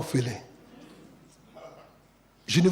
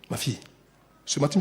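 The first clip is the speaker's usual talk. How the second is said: normal talking voice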